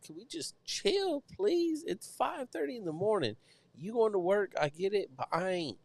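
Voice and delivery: high, whiny exasperated plea; exasperated pleading. Retold or imitated. Tired of early noise from neighbours, pleading. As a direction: beg them to settle down, re-enacted.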